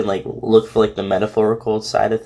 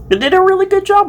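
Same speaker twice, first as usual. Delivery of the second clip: excited praise; 5 dB louder, much faster than usual. Real, not acted. Praising the fic author.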